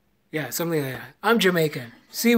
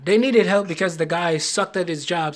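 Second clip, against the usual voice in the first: ordinary delivery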